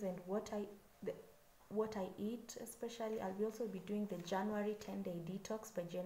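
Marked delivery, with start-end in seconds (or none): none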